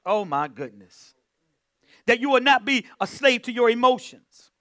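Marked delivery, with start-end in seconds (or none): 2.1-4.5 louder preaching push. Acted. Preacher urging the church, rising preaching. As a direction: press the point on the congregation. Preacher driving the point home.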